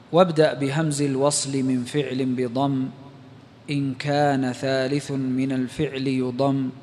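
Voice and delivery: measured, low recitation of a didactic poem; verse recitation. Real, not acted. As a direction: read the verse softly and evenly, lingering on line ends. (إلقاء منظومة بصوت منخفض ومتأنٍّ)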